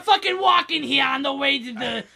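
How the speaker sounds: put-on loud, brash New York accent. New York accent, ranting about his nephew on the way to the gathering. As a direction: bark it out like a New Yorker stuck in traffic. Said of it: "classic New Yorker".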